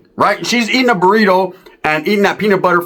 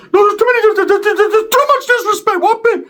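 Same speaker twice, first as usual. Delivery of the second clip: Ricky winding up. Retold or imitated. Ricky telling his cousin about disrespect, high and squeaky. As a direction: squeal it like an offended tough guy. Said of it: "when he starts talking like this".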